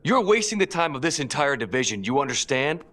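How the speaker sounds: incredulous burst, pitched high at first; angry outburst. Acted. Detective berates attorney for wasting time, angry outburst. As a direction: lash out in disbelief.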